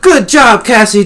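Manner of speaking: high, loud cheer; elated congratulation; jubilant praise. A narrator's reading. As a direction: cheer a teammate's win. Characters celebrate victory.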